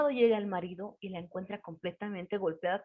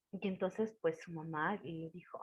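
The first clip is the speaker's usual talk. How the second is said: usual speaking voice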